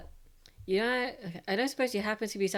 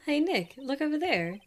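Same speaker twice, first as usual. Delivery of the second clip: put-on excited girl voice; 6 semitones higher, 4 dB louder than usual. [name] calls out excitedly.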